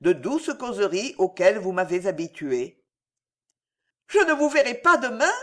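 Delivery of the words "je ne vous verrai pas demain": high, louder cry of regret; regretful exclamation. A narrator's reading. Alice to the count on missing him tomorrow, regretful exclamation. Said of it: "s'écria Alice dans la sincérité de son regret".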